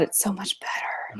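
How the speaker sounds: soft whispered aside. Real, not acted. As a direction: breathe it as a whisper.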